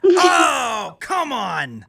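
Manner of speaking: can't believe someone said true; mock-outraged oh, come on; outraged protest. Real, not acted. Protests the Last Jedi verdict.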